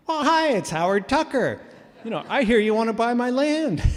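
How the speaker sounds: high, loud, cheery voice for the caller; imitating Howard. Phone call from the landowner.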